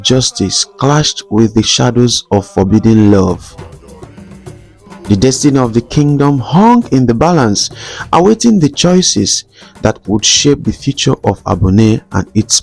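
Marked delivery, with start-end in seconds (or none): none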